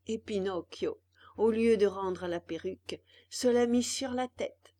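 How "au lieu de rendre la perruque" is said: ordinary delivery, nothing marked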